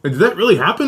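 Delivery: retold concerned disbelief. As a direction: act out an astonished sibling checking in. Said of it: "the middle child was looking at me".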